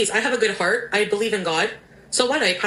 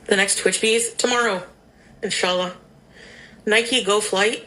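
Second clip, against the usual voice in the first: usual speaking voice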